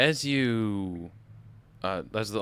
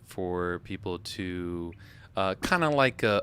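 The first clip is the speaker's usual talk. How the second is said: ordinary talk, nothing marked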